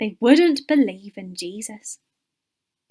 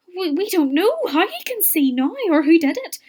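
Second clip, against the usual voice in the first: higher, stammered denial; nervous parents; 8 semitones higher than usual. A narrator's reading. Afraid parents answering the Pharisees, nervously. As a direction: stumble on the first word, pitched up anxiously. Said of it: "his parents were afraid".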